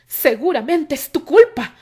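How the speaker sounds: loud, slow blaming shout; angry shout. A narrator's reading. Isaac yells blame at Terry, loud and slow. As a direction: blame him, yelling. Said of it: "gritó".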